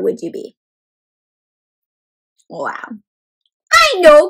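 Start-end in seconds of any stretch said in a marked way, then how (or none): 3.7-4.3 high, loud eager cry; excited cry. A narrator's reading. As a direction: pipe up with a bright idea. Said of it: "cried Froggy".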